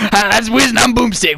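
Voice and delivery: put-on show-opener voice; Boomstick impression. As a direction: announce the duo like a hammed-up co-host. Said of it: "That was Boomstick".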